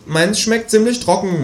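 high, slow grumble; grumbling kangaroo. Acted. Kangaroo complains about its meal, high and sulky. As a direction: sulk like a fussy animal. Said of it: "mault das Känguru".

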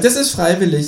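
high creature voice, earnest; Känguru. A narrator's reading. Kangaroo answers companion's sarcastic question, high earnest voice. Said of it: "sagt das Känguru".

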